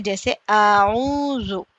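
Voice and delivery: आऊज़ु said slowly with ऊ drawn out; slow stretched vowel. Shown as a demonstration. Teacher models the long vowel for students, slowly. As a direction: linger on the ऊ of आऊज़ु. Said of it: "थोड़ा खींच कर पढ़ रहे हैं".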